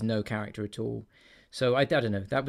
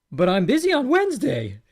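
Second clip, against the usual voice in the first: pitched up, louder, ending embarrassingly high; high sentence ending; 8 semitones higher, 6 dB louder than usual. Retold or imitated. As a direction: squeak the end of the line up like a nobody. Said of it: "the end of his sentence goes, like, embarrassingly high".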